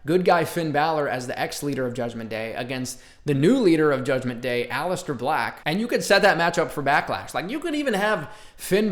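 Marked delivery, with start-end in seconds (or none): none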